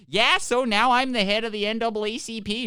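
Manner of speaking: high, loud mock Minnesota lady accent. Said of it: "You sound like a lady from Minnesota".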